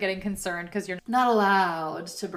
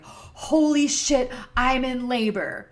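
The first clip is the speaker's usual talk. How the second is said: loud, high, slow holy shit; stunned outburst, slowly drawn out; shocked realization; 5 semitones higher, 7 dB louder, slower than usual. Retold or imitated. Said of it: "that was when I was like".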